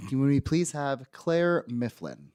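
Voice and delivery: usual speaking voice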